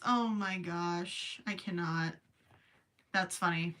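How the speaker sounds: ordinary delivery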